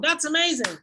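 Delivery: "That's amazing." sung out high; modeled intonation. Shown as a demonstration. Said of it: "best intonation as possible".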